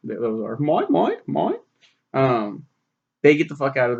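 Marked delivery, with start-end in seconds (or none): 0.6-1.6 higher squawky seagull chant; silly seagulls. Retold or imitated. Seagulls squawking to grab the fish. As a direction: chant it like greedy cartoon seagulls. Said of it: "they're silly villains".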